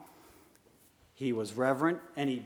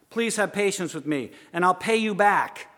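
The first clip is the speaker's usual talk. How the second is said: loud, raised plea, growing louder; pleading; 5 semitones higher, 8 dB louder than usual. Acted. Fellow servant face down before his creditor, pleading. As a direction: plead emphatically for more time. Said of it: "pled with him".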